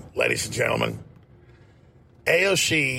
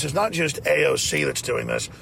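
ordinary delivery

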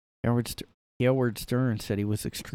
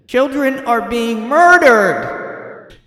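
slow, high, loud mock-crying wail; mock tearful plea; 12 semitones higher, 9 dB louder, much slower than usual. Mocking the host's on-air crying, a drawn-out high wail. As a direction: ham up a weeping talk-show host.

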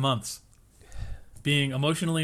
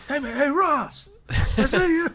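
building to a high, loud teasing call; hollering up at Ross in fun, climbing higher and louder; mock call-out; 13 semitones higher, 4 dB louder than usual. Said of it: "Uncle Carrie's like".

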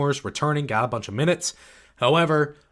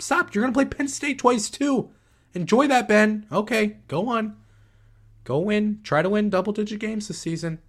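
put-on much higher, patronizing coo like soothing a child; condescending pep talk; 8 semitones higher than usual. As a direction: pat him on the head with your voice, higher and sweet. Talking down to Ben Johnson.

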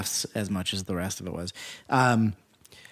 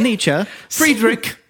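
joking emphasis; 9 semitones higher, much slower than usual. Pretend. Joking name correction.

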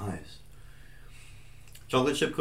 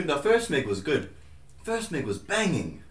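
usual speaking voice

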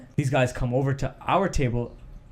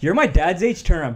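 louder, higher mocking comeback; louder retort; 4 semitones higher, 6 dB louder than usual. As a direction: punch it out at the older guys. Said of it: "the hothead was just like".

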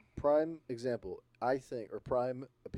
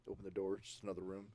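ordinary delivery

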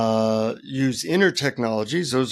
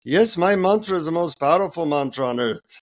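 put-on higher-pitched Indian-accented guru; 4 semitones higher than usual. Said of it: "in an Indian accent".

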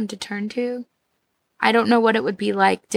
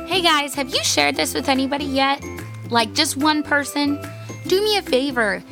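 usual speaking voice

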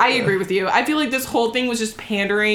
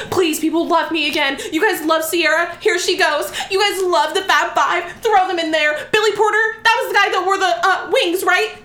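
mock pleading; 7 semitones higher than usual. Voicing [name]'s pandering.

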